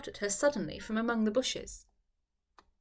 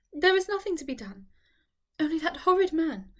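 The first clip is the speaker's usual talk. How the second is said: higher, pained and upset; tearful Eleanor; 6 semitones higher, 3 dB louder than usual. Acted. Eleanor telling Charlotte of that horrid man, near tears. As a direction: spit out horrid through tears.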